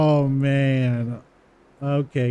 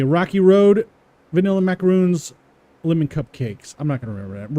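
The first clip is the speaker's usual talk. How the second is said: usual speaking voice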